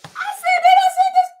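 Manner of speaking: high, loud laughing outburst; excited laughing shout. Real, not acted.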